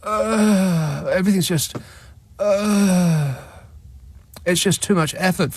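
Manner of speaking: high, long-drawn exasperated moans; mock weary whining. Host mocking a man for whom everything is effort, whining wearily. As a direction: groan as if everything is too much.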